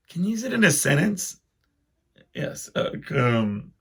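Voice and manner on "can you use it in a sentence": put-on higher kid voice asking politely; high mock girl. Spelling-bee girl asking the judges in a higher voice. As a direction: pipe up like a little contestant.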